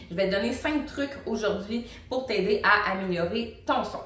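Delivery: normal talking voice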